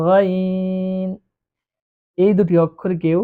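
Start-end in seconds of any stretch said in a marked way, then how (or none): 0.1-1.1 গাইন held slowly, three alif; long ghain. Shown as a demonstration. Teacher showing the class a long গাইন. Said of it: "তিন আলিফ পরিমাণ টান দিয়ে পড়তে হবে".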